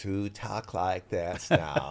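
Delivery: put-on slow, low Valley girl drawl; drawn-out Valley girl vowels, pitched low; Valley girl accent. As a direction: imitate your daughters' Valley accent. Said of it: "they really speak with this accent".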